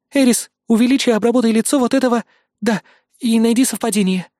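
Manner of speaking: repeating after him. A narrator's reading. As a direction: echo the order eagerly. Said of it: "повторила за мной Кайли".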